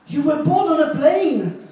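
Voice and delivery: gasp, then high, loud disbelief; mock astonishment. Someone misunderstanding the comedian's birthplace, astonished. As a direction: act out a shocked, over-the-top question.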